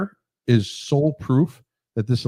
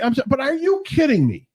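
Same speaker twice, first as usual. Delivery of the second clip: incredulous; 12 semitones higher than usual. Real, not acted. Incredulous at poll numbers.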